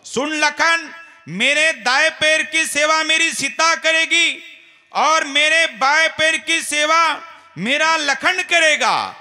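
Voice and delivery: high, loud, slowing proclamation; Rama's declaration. Acted. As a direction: announce the decision solemnly. Rama divides the service.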